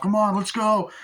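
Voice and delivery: acted escape holler; urgent urging. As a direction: call them along to flee. Acting out urging others to flee.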